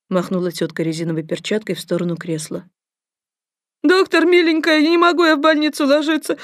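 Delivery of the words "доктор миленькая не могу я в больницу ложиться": high, loud keening plea; wailing. Acted. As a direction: wail and beg the doctor. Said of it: "заголосила блондинка".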